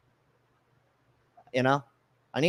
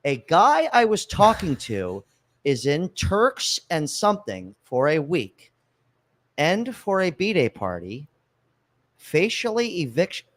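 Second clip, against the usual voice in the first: reading it slowly, word by word; puzzled slow reread of a badly written message; slow careful reread; 3 semitones higher, slower than usual. Real, not acted. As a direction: sound it out carefully like a hard text. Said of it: "why can't I read this?".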